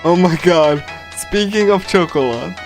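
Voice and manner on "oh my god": ordinary talk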